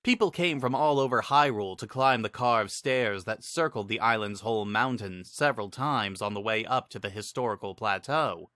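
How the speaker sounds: usual speaking voice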